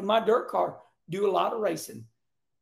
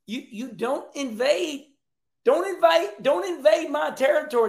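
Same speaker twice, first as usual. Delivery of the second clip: louder, higher as it builds on don't invade; mock angry warning; 6 semitones higher, 4 dB louder than usual. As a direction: imitate a driver guarding his turf. Acting out Ross's warning.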